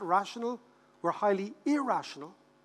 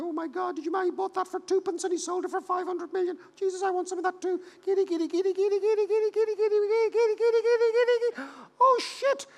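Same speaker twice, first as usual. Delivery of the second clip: very high squeaky hysteria, building louder; hammed-up crowd working itself into a frenzy; high-pitched manic gabble, getting louder to a peak; giddy frenzy; 12 semitones higher, 4 dB louder than usual. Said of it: "we all get giddy together".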